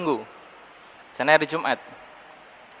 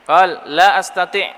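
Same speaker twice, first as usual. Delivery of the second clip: hadith recitation; 4 semitones higher, 8 dB louder, slower than usual. Acted. Quoting the hadith in Arabic.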